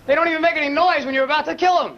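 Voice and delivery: usual speaking voice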